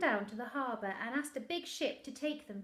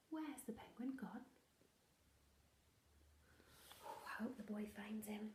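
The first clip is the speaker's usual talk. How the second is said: whisper; 2 semitones lower, 11 dB quieter than usual. Acted. Reader's worried aside to children.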